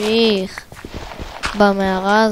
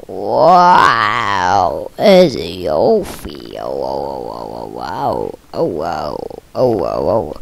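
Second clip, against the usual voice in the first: much lower, slowed-down wow; mock deep wow. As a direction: drag the wow deep and slow like a slowed tape.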